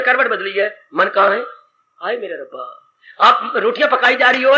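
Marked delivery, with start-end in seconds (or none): 2.0-2.8 low, soft sigh to God; acted, sunken groan of complaint; weary lament. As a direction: sigh it out under the breath, as if worn out. Voicing a restless devotee's lament.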